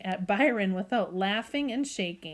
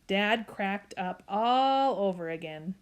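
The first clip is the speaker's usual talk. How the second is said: usual speaking voice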